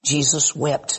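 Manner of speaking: usual speaking voice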